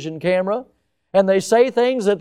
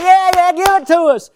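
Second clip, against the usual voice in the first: mock cheering crowd; 12 semitones higher, 8 dB louder than usual. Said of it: "everybody going".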